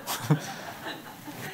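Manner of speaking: amused preacher laughing at his own joke. Real, not acted. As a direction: chuckle while you say it.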